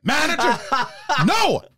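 high, emphatic movie-star impression in short bursts; Al Pacino. Actor insists his co-star is not Irish, high Pacino impression. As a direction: protest in halting high phrases, hammed up. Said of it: "Pacino just went from being like that really high voice".

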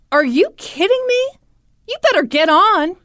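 high, loud acted retort; incredulous anger. Father answering the woman's demand for proof, outraged. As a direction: imitate a father's outraged disbelief.

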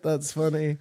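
ordinary talk

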